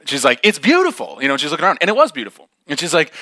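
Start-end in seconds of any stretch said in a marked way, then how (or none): 0.4-1.2 high, loud mock rapture; proud excitement. Girl admiring the view from her false summit, excited. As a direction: exclaim it as if overawed. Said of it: "pretty proud of herself and pretty excited".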